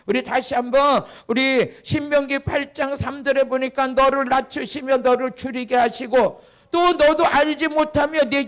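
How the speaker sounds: loud, forceful Bible reading from the pulpit; preacher declaiming at full voice; scripture declamation. Real, not acted. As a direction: proclaim the verse to the congregation.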